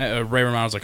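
usual speaking voice

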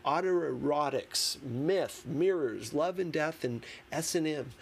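ordinary delivery, nothing marked

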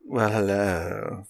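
a hint of a low, slow, soft sleazy Well, hello; lightly voiced seducer. Host demos the sleazy voice, low and slow.

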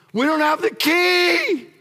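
high, loud panicked shout; the weeping crowd in heaven shouts in panic; panicked crowd cry. Acted. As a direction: cry it out like frightened mourners.

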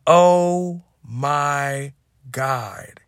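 very slow, drawn-out disbelief; slow, awed oh my God at the wreck; stunned oh my God. Retold or imitated. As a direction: stretch it out, frozen in shock. Shocked at burnt kitchen.

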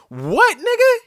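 shocked outraged yell; outraged shout. Real, not acted. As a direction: explode at him. Outraged at the TV hog.

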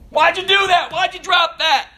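sharp, high, exasperated scolding; scolding parent. Retold or imitated. Parent scolding a child for a mistake, exasperated. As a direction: snap at the kid for slipping up.

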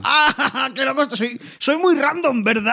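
high, giggling mock madman; wannabe crazy villain. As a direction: laugh and play a fake lunatic. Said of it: "es un wannabe".

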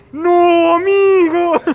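high, very loud mock-crying shout; dismayed wail. Hearing what the friend asked his crush, dismayed wail. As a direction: wail it at him in acted despair. Acted reaction to friend's confession.

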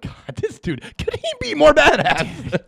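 rising high and slow into an awed squeal; hammed-up excited disbelief; gushing fan awe. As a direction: swoon over the hero, stretched out. Retelling his own reaction.

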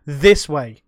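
loud firm no, this way; pushy correction. Retold or imitated. Leaners at the bar hatch, loud and pushy. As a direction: insist loudly. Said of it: "step on the bar and say".

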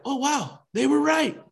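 high-pitched acted amazement; imitated astonished realization; mock surprise. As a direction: act as if it just dawned on you. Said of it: "and we act surprised".

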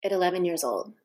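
ordinary talk, nothing marked

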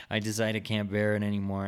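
usual speaking voice

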